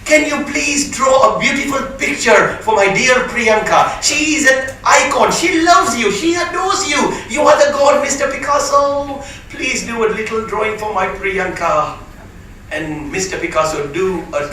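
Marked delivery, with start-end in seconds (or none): 0.0-11.9 high, gushing society lady pleading; mock-adoring woman begging a favour; gushing lady fan. Lady begging Picasso for a drawing, high gushing fangirl voice. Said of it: "she goes".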